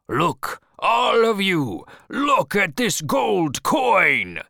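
loud, high, slow proclamation; crying out. Acted. Captain showing the crew a gold coin, crying out. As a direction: stretch each word in a big commanding shout. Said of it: "he cried".

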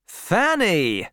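taken aback; surprised. A narrator's reading. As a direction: exclaim the name in astonishment. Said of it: "said Troy in surprise".

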